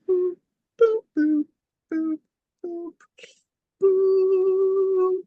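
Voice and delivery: high, pure mimicked beeps, last one long; imitated beeps. Retelling a stranger working her phone, high imitated beeps. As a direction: beep like an electronic gadget, high and loud.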